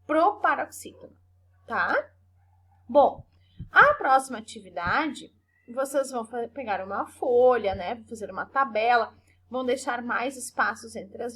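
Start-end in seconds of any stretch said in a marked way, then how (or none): none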